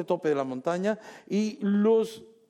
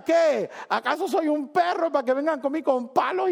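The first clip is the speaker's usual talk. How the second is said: high, loud taunting yell across the valley; mocking shout; 10 semitones higher, 3 dB louder than usual. Goliath taunting the Israelites with a high loud yell. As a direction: bellow it like a giant mocking the enemy. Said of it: "Goliat gritándole".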